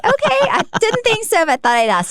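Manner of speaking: a high, playful take on backing off; lightly mocking okay; high mock-sweet reply. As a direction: sing it up high like a sheepish mom. Mom retells her reply to son.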